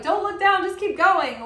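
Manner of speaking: urgent coach-like call; urgent urging. Acted. Urging pep talk quote.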